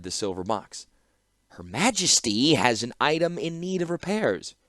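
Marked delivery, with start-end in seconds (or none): 1.5-4.5 higher pitch on Her Majesty; stressed introduction. Acted. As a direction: lean on Her Majesty so there is no doubt. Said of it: "stressing the introduction".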